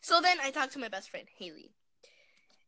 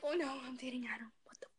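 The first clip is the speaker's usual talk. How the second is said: panicked whisper; 7 dB quieter than usual. Retold or imitated. Retold panicked inner thought.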